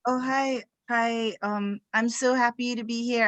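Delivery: ordinary delivery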